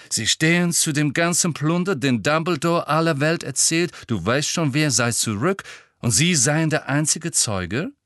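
high, loud, pressing interrogation; sceptical reporter. A narrator's reading. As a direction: fire off incredulous questions in character.

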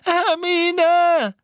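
very high, desperate screams; frantic anguished yell; shouting. A narrator's reading. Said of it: "Ron rief erneut".

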